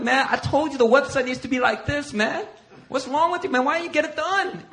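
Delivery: high-pitched exasperated berating; going off angrily. Retold or imitated. Pastor going off on Marcus, mock-angry scolding. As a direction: tear into him in mock frustration. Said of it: "I just go off on Marcus".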